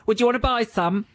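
put-on high-pitched kid's comeback; cheeky schoolkid. Pupil's quick reply to the outraged teacher, high cheeky kid voice. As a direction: imitate a pupil, voice pitched well up. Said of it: "To which the kid replied immediately".

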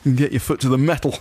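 blustering office chap. Retold or imitated. Replays office chap's phrases.

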